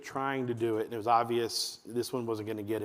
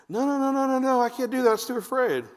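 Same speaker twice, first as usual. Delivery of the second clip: very high, loud mock-scared child; hammed-up childish panic; panicky kid; 11 semitones higher, 6 dB louder than usual. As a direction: protest like a terrified little kid. Acting the scared kid refusing.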